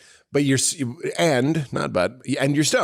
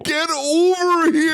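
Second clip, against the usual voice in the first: higher, louder, slow yell; game catchphrase shout; 11 semitones higher, 4 dB louder, slower than usual. Pretend. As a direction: bellow like Scorpion.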